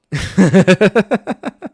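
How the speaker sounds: loud, high burst of laughter; long staccato laugh, rising in pitch; real laughter. Real, not acted. Streamer laughs at viewer's broken-stream remark.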